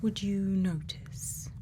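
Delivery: ordinary talk, nothing marked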